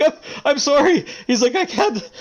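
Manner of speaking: high, loud, broken apology on the edge of tears; breaking apology. Acted. Shopkeeper collapsing before the adventurers, high tearful apology. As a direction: beg forgiveness, falling apart. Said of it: "drops the sword and puts his hands up".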